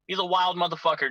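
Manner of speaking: usual speaking voice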